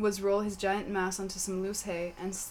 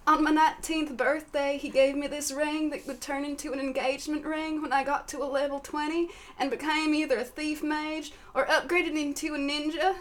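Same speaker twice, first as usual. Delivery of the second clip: lady voice; 7 semitones higher, 4 dB louder than usual. Acted. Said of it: "said Sally Minefield".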